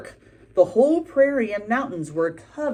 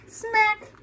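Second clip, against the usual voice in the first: kiss smack. A narrator's reading. Kiss sound in story.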